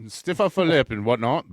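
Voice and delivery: hammed-up British accent, chipper. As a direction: keep up the jolly English toff voice. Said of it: "you decided to do a British accent".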